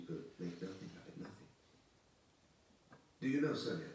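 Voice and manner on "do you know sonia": loud, higher burst of conviction; sudden conviction. Acted. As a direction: break out suddenly, sure of it. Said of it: "suddenly with conviction".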